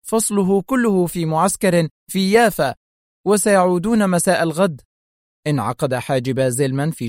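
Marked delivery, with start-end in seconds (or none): 0.1-4.8 higher, mildly hurried explanation. A narrator's reading. Secretary explains to menacing Zelman, somewhat hurriedly. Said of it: "فاستطردت في سرعة".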